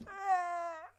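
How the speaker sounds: seal-like sad whimper. Real, not acted. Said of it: "Why do I sound like a seal when I'm sad?".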